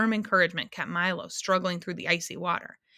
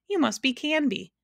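higher-pitched, pleased deduction; bright conclusion; 7 semitones higher than usual. A narrator's reading. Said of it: "Milo concluded brightly".

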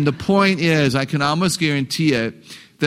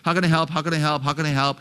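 even, quiet repetition sinking a little; repetitive broken record; 3 dB quieter, faster than usual. Retold or imitated. Mom answering her whining son, calm broken record. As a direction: repeat it like an unmoved mom. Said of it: "the mom just kept saying".

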